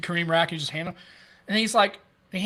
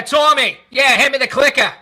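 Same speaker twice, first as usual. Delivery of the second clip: loud Boston accent, higher and louder; 8 semitones higher, 11 dB louder, slower than usual. Shown as a demonstration. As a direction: call out to Tommy in a thick Boston accent. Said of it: "see, I can kinda do it".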